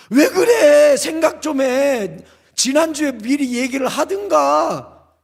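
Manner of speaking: high, drawn-out exasperated scolding, loud at first; whining reproach, stretched words; acted nagging spouse. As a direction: play the fed-up partner protesting. Acts spouse scolding sudden churchgoer.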